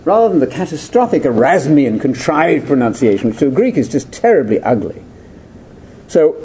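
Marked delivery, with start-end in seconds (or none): none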